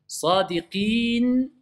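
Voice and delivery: SADIQIN with a long I and audible final N; without ghunna. Shown as a demonstration. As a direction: stretch sadiqin slowly, stopping on the N. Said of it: "c'est sans runna".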